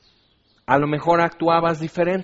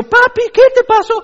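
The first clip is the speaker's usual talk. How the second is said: very high, loud mock child wail; hammed-up child's cry for daddy; 11 dB louder than usual. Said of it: "niños llorando".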